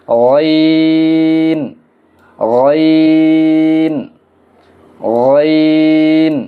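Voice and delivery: গইন with the vowel held very long on a level tone; drawn-out recitation. Shown as a demonstration. Teacher reciting an Arabic letter for learners, গইন drawn out long. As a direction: stretch গইন slowly, holding an even note. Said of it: "আমরা পড়ি".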